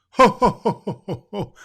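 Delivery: belly-laugh it out like Santa; jolly Santa ho ho ho. Acted. Santa greeting the letter writer with a loud jolly laugh.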